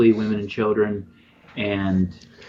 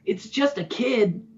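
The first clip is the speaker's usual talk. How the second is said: put-on high, slow little-kid voice; child's voice; 12 semitones higher, slower than usual. Child villager sizing up the stranger, high child voice. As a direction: speak up high like a small child. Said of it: "you hear one of the children say".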